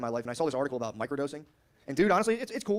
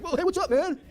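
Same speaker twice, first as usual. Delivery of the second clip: much higher, bright-eyed cashier greeting; peppy cashier; 13 semitones higher, 4 dB louder than usual. Retold or imitated. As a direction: play the overly peppy Whole Foods clerk. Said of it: "they're all peppy, like".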